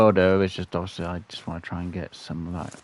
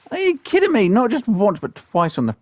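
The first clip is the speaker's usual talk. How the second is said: loud, high disbelief; exasperated yell; 12 semitones higher, 8 dB louder than usual. Real, not acted. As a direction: throw the complaint at the sky. Still venting after missing twice.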